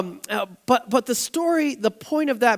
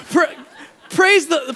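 laughing; 6 semitones higher, 4 dB louder, slower than usual. Real, not acted. Laughing praise.

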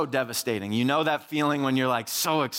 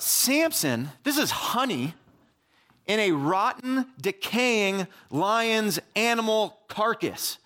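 incredulous; 5 semitones higher, slower than usual. Pretend. Addressing Samson in disbelief.

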